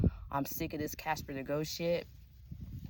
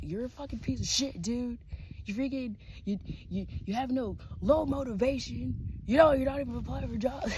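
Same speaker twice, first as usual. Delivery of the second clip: imitating a man berating his partner in a higher voice; hammed-up yelling insult, pitched up; mock angry rant; 9 semitones higher, 4 dB louder than usual. Retold van argument, dude yelling.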